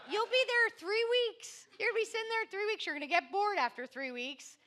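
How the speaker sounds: high, scoffing sister; mocking sister. Sister predicting his boredom, high scoffing voice. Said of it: "She's like".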